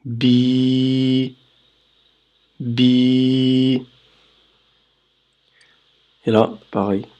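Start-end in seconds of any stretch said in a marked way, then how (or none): 0.1-3.8 bi held long; long vowel bi. Shown as a demonstration. Teacher repeating the long vowel bi, drawn out. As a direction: stretch the i in bi. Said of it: "ici on va dire bi".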